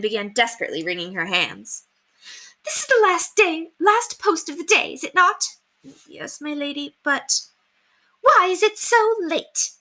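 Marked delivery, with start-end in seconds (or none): 2.6-5.6 high, anxious lady's voice pressing; desperate. Acted. Distraught lady presses the maid about the post. 6.1-7.4 timid maid. Acted. Timid maid answering mistress. 8.2-9.7 high, slow anxious demand; desperate. Acted. Distraught lady demands why the post is late.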